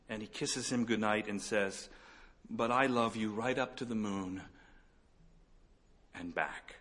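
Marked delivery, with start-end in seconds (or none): 2.5-6.7 tender hush. Retold or imitated. Father hare to sleepy child.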